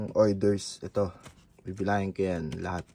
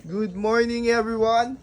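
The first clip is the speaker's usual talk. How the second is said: higher, louder good morning; cheery loud greeting; 12 semitones higher, 4 dB louder, slower than usual. Real, not acted. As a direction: greet the whole house. Greeting everyone loudly.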